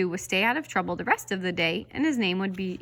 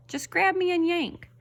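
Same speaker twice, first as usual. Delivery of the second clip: much higher egging-on voice; coaxing pigtail; 10 semitones higher, 3 dB louder than usual. A narrator's reading. Pigtail egging Paul on, high and sly. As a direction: tempt him, sly and high.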